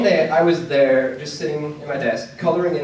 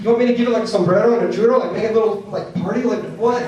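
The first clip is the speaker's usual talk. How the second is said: rising, louder disbelieving rant; incredulous; 6 semitones higher, 4 dB louder, faster than usual. Retold or imitated. Student mocking his Spanish teacher, incredulous and louder. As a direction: throw the absurd idea back at her.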